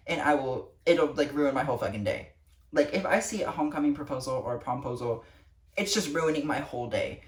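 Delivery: ordinary talk, nothing marked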